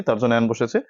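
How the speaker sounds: usual speaking voice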